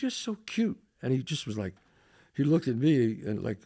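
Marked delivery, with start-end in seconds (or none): 0.0-0.8 lightly imitated flirty coo; higher, softer mock flirting; a hint of a cooing date; flirty gushing. Repeats date's flirting with waiter.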